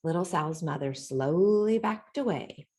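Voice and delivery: plain reading voice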